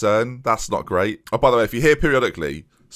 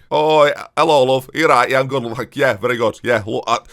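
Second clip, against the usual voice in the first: louder, broad Yorkshire chat, love and duck; Yorkshire accent; 5 dB louder than usual. Retold or imitated. Mimicking a Leeds local, louder, in a broad Yorkshire accent. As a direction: ham up a friendly Yorkshire local. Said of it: "Let's, let's try do it".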